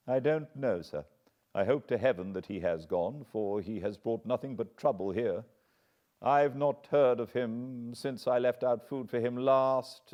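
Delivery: a hint of a nervous old butler; lightly voiced butler. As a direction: answer like a worried servant. Barrymore voiced by narrator.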